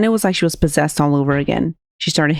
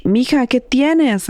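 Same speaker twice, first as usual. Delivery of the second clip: retold mother's cry; 7 semitones higher, slower than usual. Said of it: "Victoria yells".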